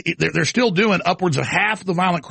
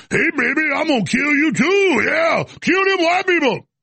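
swaggering caricature, pitched way up; Jasmine Crockett new voice; 8 semitones higher than usual. Acted. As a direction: holler it in an exaggerated put-on accent. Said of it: "Now you're like".

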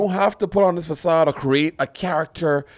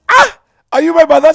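high, loud theatrical cry; exaggerated raised-voice question; skit impression; 11 dB louder than usual. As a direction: recreate the skit with a hammed-up shout. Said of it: "I said".

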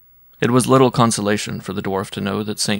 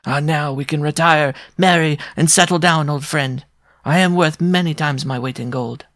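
higher, delighted dwarf crying out; joyful outcry; 6 semitones higher, 3 dB louder than usual. Acted. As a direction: burst out with glee at the news. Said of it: "cried out".

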